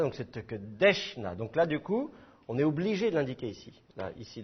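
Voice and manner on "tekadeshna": Tekadeshna, lent, appui sur « desh »; détache « Tekadeshna » en accentuant « desh », pas le « na » final; accent sur l'avant-dernière syllabe. Shown as a demonstration. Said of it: "il faut savoir que c'est pas là". (accentuation pénultième de « Tekadeshna »)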